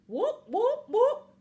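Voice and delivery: high, loud rising whoops; vocal siren sound effect; imitated alarm siren. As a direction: whoop like an alarm, sweeping up each time.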